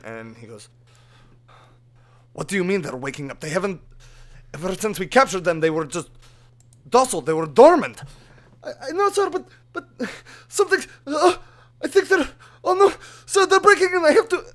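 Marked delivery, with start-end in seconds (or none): 2.3-8.0 rising, louder alarmed king demanding answers; king's disbelief building to a shout. Acted. King demands how dormant prisoners woke, alarmed. 8.6-14.4 put-on very high, loud Russian accented panic; guard cut off as prisoners break in; panicked guard. Guard panics as the prisoners break in, high Russian accent.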